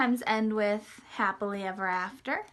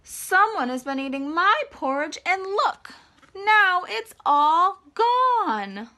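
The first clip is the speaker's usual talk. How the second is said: high, louder, slowing to a wail; upset baby bear voice; 7 semitones higher, 6 dB louder, slower than usual. Acted. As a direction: whine like a small cub who lost breakfast. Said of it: "Baby Bear said".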